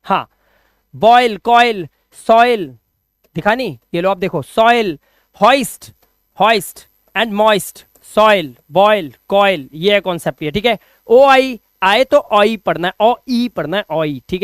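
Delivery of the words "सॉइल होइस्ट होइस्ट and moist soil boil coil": slow demo. Shown as a demonstration. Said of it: "slow reading of examples".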